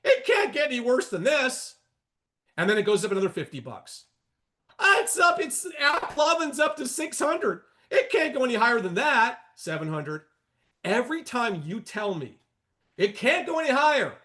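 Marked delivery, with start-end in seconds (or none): 0.0-1.8 mock trader confidence. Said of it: "And you're like". 4.8-10.2 high, loud mock shout of a panicking short seller; hammed-up alarmed yelling, pitched way up; mock panicked trader. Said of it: "imitating panicked short trader".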